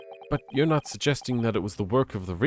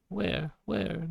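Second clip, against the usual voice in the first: a higher, much softer barked query; mock German guard; 8 semitones higher, 9 dB quieter than usual. Player voices a suspicious German soldier, mock German voice. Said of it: "a cold in nineteen forties Germany".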